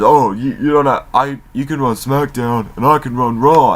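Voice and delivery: a hint of a bumbling executive; mock Laurinaitis. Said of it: "Laurinaitis says".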